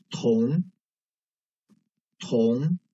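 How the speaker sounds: 同 in second tone, rising; tone drill. Shown as a demonstration. Teacher reading for students to circle, 同 rising. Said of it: "focus on the tones".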